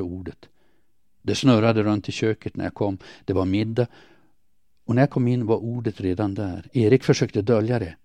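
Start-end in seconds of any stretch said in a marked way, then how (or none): none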